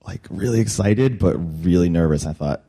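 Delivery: normal talking voice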